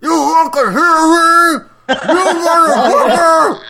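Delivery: high, very loud muffled yell; hammed-up impression of yelling through a gag; gagged yelling. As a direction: shout furiously through a gag. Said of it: "he's yelling at the lawyer".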